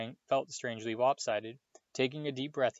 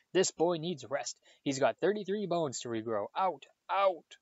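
higher scolding, rising to sharp orders; shouting; 6 semitones higher, 4 dB louder than usual. A narrator's reading. Madame Pomfrey throwing out the team, raised-voice scolding. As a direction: order them out, pitch climbing to the last word.

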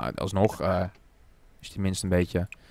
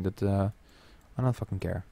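ordinary talk

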